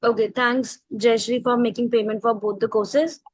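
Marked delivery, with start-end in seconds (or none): none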